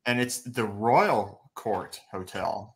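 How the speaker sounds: slow, with Royal leaned on hard; stress on Royal. Real, not acted. Correcting the hotel name, slow with stress on Royal. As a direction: Royal drawn out and stressed, set against plain Court. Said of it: "It's not just the Court Hotel".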